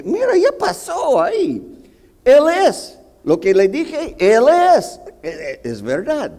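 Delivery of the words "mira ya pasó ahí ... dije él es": mucho más agudo y fuerte, insistente; defensa exaltada. Retold or imitated. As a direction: se justifica ante su esposa. Se defiende ante la esposa. (justificación exaltada ante la esposa)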